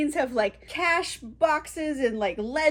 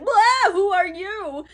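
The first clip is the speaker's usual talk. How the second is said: higher, louder, slow startled cry; startled yelp; 7 semitones higher, 6 dB louder, slower than usual. Retold or imitated. Scrooge wakes to the ghost, yelping in surprise. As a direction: jump at the ghost.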